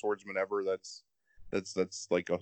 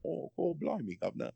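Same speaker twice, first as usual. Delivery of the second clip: mock British accent; 4 semitones higher, 7 dB quieter than usual. Shown as a demonstration. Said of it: "I wanna hear this accent".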